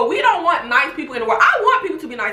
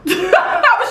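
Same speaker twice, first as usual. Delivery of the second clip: high real laughter; real laugh at herself; 10 semitones higher, 4 dB louder than usual. Real, not acted. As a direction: burst out laughing. Laughing after calming down.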